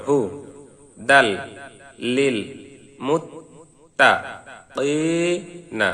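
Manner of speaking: broken-up syllables, each one set apart by a pause; slow separated syllable drill. Shown as a demonstration. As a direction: say each syllable alone, then stop. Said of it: "শুরুতে আসুন ভাঙ্গা ভাঙ্গা উচ্চারণ".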